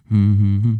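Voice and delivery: rhythmic chest hum like the Wolf of Wall Street scene; McConaughey hum. Retold or imitated. Imitating his racing heart as a McConaughey hum. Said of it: "Full Matthew McConaughey".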